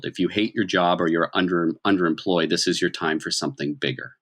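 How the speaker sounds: ordinary talk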